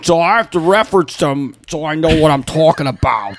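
Steve impression. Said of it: "That was fake Steve".

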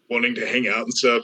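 ordinary delivery

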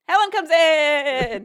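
high, drawn-out triumphant announcement; playful fanfare; mock heroic cheer. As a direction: herald the rescuer, slow and loud. Cheering the rescuer's arrival.

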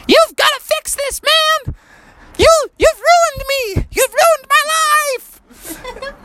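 very high squeaky wail of blame; tiny upset frog. Acted. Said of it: "so his voice was so high".

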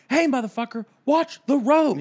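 loud, high-pitched scolding yell; exasperated shout, acted out; angry outburst. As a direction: bawl out a careless driver. Imagined outburst at the driver.

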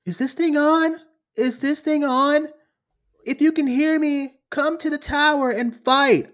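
high, loud hammed-up rallying call; mocking, high-pitched broadcast plea; mock movie-heroine speech. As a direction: imitate an overacted call to arms. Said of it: "when she is like".

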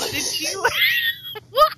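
high shrieking laugh; laughing crying. Real, not acted. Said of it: "I'm crying".

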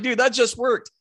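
high-pitched excited retelling; thrilled disbelief. Retold or imitated. Hunter to his buddy as the plan works, giddy amazement. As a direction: marvel at the plan working. Retold delight that plan worked.

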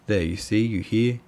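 usual speaking voice

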